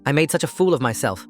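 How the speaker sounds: usual speaking voice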